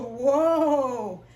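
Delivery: loud, drawn-out mock whoa; surprised whoa. Jesus reacts as Jacob holds on, surprised. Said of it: "Jesus goes".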